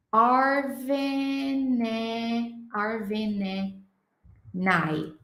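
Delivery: stretched very slowly, word by word; slow, separated demo of the phrase; very slow split-up. Shown as a demonstration. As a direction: pull each word apart slowly. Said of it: "ficaria tipo assim, ó".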